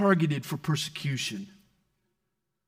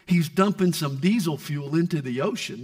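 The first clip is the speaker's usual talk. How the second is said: usual speaking voice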